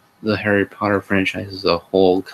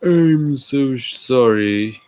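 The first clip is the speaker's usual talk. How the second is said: higher, very slow bashful apology; shy voice; 4 semitones higher, 3 dB louder, much slower than usual. Acted. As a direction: drag out a timid, overdone apology. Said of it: "he said in a shy voice".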